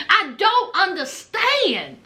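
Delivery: normal talking voice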